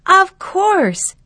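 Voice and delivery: high, loud burst of realisation; sudden realisation. Acted. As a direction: it suddenly clicks, blurt it out. Sudden realisation after murmuring.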